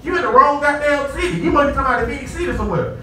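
mock the lost driver.

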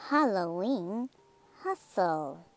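plain reading voice

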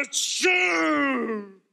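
hammed-up big sneeze. Mangiafuoco sneezes. (starnuto teatrale)

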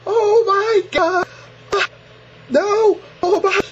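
squealing in real disgust, begging it to stop; horrified screams. Real, not acted. Screaming at gory video.

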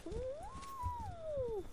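high ooh. Real, not acted. Sees dinosaurs in game.

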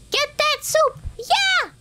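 high-pitched gleeful cry; squealing little victory shout; excited cheer. Real, not acted.

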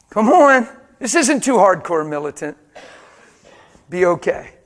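loud, pleading call; rally the room; preaching at full force. Acted. Pastor rallying his congregation, loud.

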